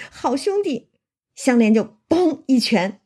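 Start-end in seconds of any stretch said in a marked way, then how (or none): 0.1-0.9 a mild whimper of a beaten man; lightly voiced moan, a bit higher. A narrator's reading. Beaten Xue Pan begs Xianglian, mild whimper. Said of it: "薛蟠就哼哼着".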